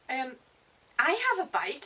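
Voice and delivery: put-on high, loud, hesitant French boy; French accent. A narrator's reading. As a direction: offer it eagerly in a French accent. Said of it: "sounds French, like my French teacher".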